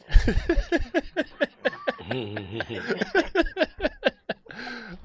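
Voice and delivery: long high-pitched cackle; laughing. Real, not acted. Cracking up at a nickname.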